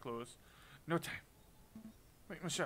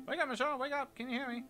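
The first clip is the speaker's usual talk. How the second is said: much higher, louder calls to wake her; urgent calling; 11 semitones higher, 6 dB louder, faster than usual. Acted. Partner trying to rouse unconscious Michelle, urgent calling. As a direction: shake her awake with your voice.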